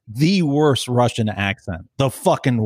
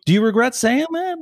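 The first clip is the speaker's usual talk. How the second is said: interviewer's question pitched much higher; mock interviewer; 12 semitones higher than usual. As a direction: ask it like a prim reporter, voice way up high. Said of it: "she's like".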